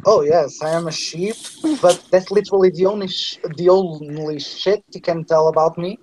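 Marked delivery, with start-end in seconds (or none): none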